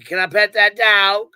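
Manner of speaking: mock innocent ask. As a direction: ask it loudly, playing dumb. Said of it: "walk up to Justin and say".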